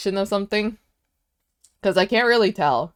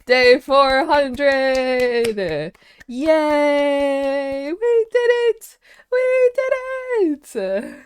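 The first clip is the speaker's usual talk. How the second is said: very high, drawn-out squealed cheering; hammed-up celebration; 9 semitones higher, 6 dB louder, much slower than usual. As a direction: cheer in a silly, loud high voice. Celebrating a 400-day streak.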